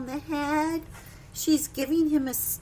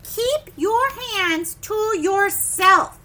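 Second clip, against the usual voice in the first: loud, slow warning; teacher warning David, loud and slow; stern order; 5 semitones higher, 8 dB louder, slower than usual. Acted. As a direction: warn him firmly. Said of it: "There's another one".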